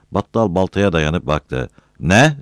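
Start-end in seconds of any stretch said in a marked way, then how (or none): 2.0-2.4 high, loud, curt challenge; irritated question. A narrator's reading. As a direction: ask it with annoyance. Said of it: "diye sordu, sinirliydi".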